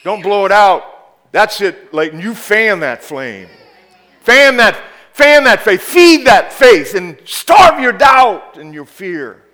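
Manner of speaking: high, loud, fired-up exhortation; preaching at full force. Acted. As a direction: drive it home to the congregation.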